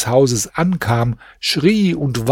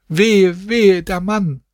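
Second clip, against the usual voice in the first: higher keening wail; high wailing cry; 6 semitones higher, slower than usual. A narrator's reading. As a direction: wail for the dead man. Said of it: "und schrien". (hohes Klagegeschrei)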